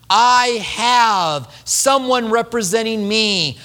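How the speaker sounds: drawn-out sincere assurance; slow, earnest preaching. Acted. Pastor assuring the congregation of their high priest, slow and sincere. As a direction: speak it slowly, like a promise.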